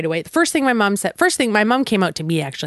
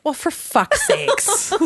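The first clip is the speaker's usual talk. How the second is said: high, drawn-out mock exasperation; retold exasperation; 11 semitones higher, much slower than usual. Couple reacts to the priest's announcement, exasperated. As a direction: drag it out in disbelief.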